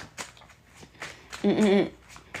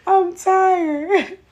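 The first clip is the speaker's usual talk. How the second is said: high, loud, laughing half-cry; laughing, near tears; 13 semitones higher, 6 dB louder, much slower than usual. Real, not acted. Reader thanking Ann, laughing high and loud. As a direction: laugh until it almost turns to tears.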